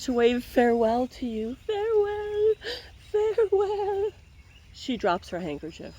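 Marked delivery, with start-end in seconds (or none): 1.7-2.5 much higher farewell, drawn and sweet; sing-song farewell. Acted. Lady waving farewell. 3.1-4.1 much higher, slower, hammed-up crying farewell; mock tearful farewell. Lady waving farewell.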